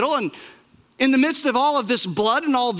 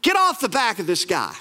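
lightly voiced thief, raised and pleading; the thief calls out the other criminal, a little higher; thief's rebuke; 5 semitones higher than usual. Preacher voicing the thief who rebukes the other criminal, raised. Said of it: "In other words".

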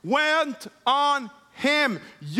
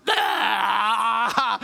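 put-on long wailing toddler cry; imitated freak-out; 3 semitones lower than usual. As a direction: imitate a child bawling in panic. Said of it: "he's freaking out like this".

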